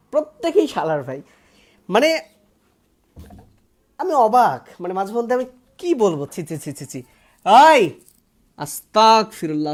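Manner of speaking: normal talking voice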